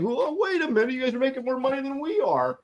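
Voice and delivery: high whiny complaining voice. Retold or imitated. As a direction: whine it like a greedy exec. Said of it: "reenact that whiny Marvel voice again".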